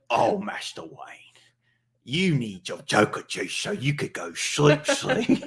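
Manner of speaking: hammed-up servant, pitch swooping upward; mock butler voice. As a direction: coax the master to sleep in a silly voice. Servant coaxes Batman in skit.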